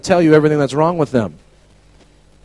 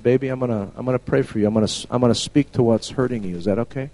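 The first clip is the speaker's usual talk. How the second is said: soft, low gentle voice; gentle to a child; 3 semitones lower, 7 dB quieter, faster than usual. Retold or imitated. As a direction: speak tenderly to a little girl. Kneels and speaks to child.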